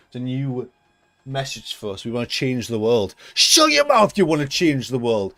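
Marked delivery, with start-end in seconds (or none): 3.3-5.3 mock outburst. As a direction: bark it out in mock anger. Sudden outburst at Jaguar.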